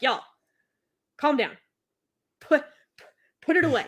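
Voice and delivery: mock-exasperated telling-off in short bursts; playful reprimand, punched out; exasperated scolding. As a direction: tell them off with pauses between commands. Scolding swooning women in acted quote.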